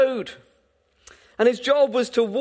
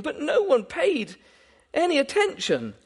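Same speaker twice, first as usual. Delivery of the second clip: ordinary talk, nothing marked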